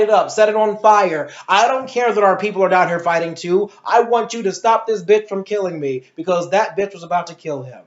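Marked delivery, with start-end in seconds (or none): none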